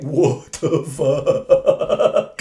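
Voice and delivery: slow, laughing what the; laughing as Manchester, shocked; laughing disbelief. Retold or imitated. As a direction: stretch it out through a laugh. Manchester stunned, teller laughing.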